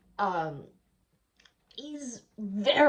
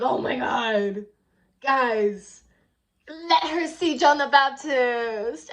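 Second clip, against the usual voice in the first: exasperated giving in; 3 semitones higher, 6 dB louder, slower than usual. Retold or imitated. Guard gives in to Salome.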